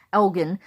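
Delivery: ELGIN with a hard g; Scottish pronunciation. Shown as a demonstration. Woman introducing her Scottish version of the town's name, hard-g ELGIN. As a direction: say ELGIN with g as in get. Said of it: "it's pronounced Elgin".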